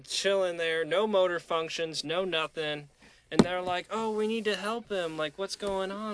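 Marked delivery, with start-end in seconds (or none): 3.9-6.2 mock fussing girls. Girls fussing over drunk.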